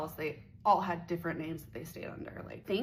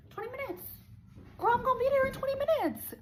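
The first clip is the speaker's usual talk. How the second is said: put-on much higher, sing-song girl voice; fake breezy reassurance; 4 dB louder than usual. Hours away, she chirps a breezy lie to him. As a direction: chirp it, lying sweetly about the time. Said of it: "'Cause I kept saying to him".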